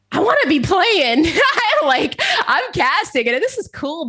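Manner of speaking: high, loud, laughing outburst; Dream Crazy. Acted. Alter ego pipes up about wanting to play, high excited outburst. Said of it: "Dream Crazy was like".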